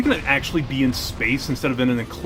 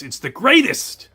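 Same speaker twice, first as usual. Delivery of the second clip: excited; 8 semitones higher, 4 dB louder than usual. Real, not acted. Loves flying levels.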